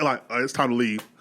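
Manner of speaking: ordinary delivery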